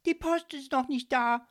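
high, loud indignant outburst. A narrator's reading. Ghost complaining that the post is late, indignant. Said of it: "antwortete er empört".

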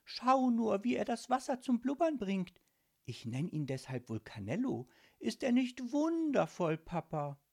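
high, delighted daughter; cheerful girl winning over her father; smiling young girl. A narrator's reading. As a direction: show off your pet eagerly in a high child's voice. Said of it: "antwortete Mirabella lächelnd".

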